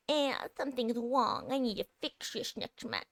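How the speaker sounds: high, whiny complaining voice; whiny baby voice. Retold or imitated. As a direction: whine it like a fussy baby. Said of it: "my whiny baby voice".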